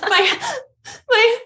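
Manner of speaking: high, gasping mock sobs, words breaking apart; imitated bawling. Telling the cop what happened, hammed-up gasping sobs. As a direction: act out crying too hard to get words out. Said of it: "just instant bawling. Like, I couldn't even speak".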